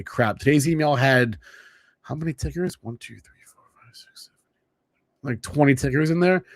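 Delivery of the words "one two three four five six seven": counting, fading to a whisper. Real, not acted. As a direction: let the count die into a whisper.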